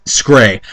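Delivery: "Scray" said as skray, low; mispronounced scary. Shown as a demonstration. Mocking the fanfic's spelling, "Scray" as skray. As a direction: drop the vowel: "Scray" as skray. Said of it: "That's a new way to say scary".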